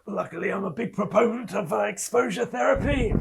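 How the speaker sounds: high, strained hoarse mock whisper; hoarse self-whisper. Player's character talking himself into the muck, hoarse mock whisper. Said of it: "very hoarsely, I will whisper to myself".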